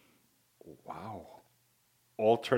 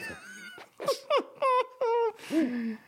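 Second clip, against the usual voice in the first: high squealing laugh. Real, not acted.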